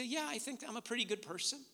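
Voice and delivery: self-satisfied average person. Retold or imitated. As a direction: imitate someone mildly pleased with themselves. Voicing most people's self-satisfied answer.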